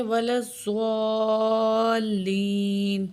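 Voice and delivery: الضالین with the madd drawn out long; very slow recitation with madd. Shown as a demonstration. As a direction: stretch الضالین slowly. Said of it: "تھوڑے سے کھینچیں گے".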